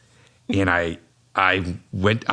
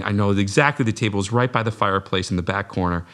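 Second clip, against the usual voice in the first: usual speaking voice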